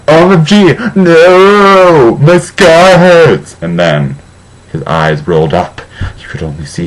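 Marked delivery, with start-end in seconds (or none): 0.1-3.6 high, loud, slow hammed-up scream. A narrator's reading. Vampire screaming about his hurting scar, hammed-up shriek. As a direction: wail it out in over-the-top agony. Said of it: "Just then he started to scream".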